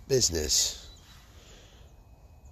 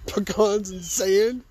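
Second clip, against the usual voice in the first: higher, slow rising "Pecans and sand?"; incredulous question; 9 semitones higher, 4 dB louder, much slower than usual. Real, not acted. Speaker to audience, incredulous and slow. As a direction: drag it out in disbelief. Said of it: "Pecans and fucking sand".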